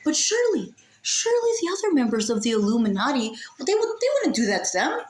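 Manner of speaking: high, mock-hopeful pleading, climbing up; sarcastic false hope. Venting to viewers about the movie, mock-hopeful sarcasm. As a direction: pretend to believe someone will save the day.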